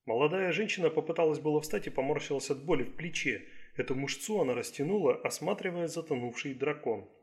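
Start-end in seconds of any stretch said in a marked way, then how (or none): none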